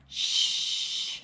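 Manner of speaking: lips rounded, "Shh" held as a long airy hiss; sh sound. Shown as a demonstration. Teacher showing her mouth, a breathy "Shh". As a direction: show them the "Shh" shape and blow. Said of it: "So look at my mouth".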